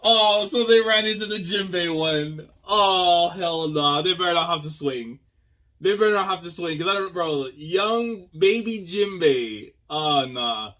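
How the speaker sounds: loud, high, excited reaction; real hype at the screen; excited outburst. Real, not acted. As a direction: shout it out in hyped disbelief. A reactor sees young Jinbei.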